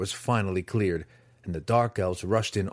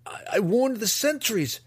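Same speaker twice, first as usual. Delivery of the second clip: much higher, stammering protest; stuttering; 13 semitones higher than usual. Acted. Drizzt stammering his defence to the master. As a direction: stumble over the first word. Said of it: "Driz stuttered".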